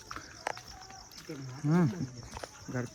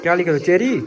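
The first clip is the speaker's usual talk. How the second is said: higher, louder demanding question; loud stern check; 4 semitones higher, 13 dB louder than usual. Real, not acted. Uncle sternly checking niece's spelling, loud. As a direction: check her work sternly.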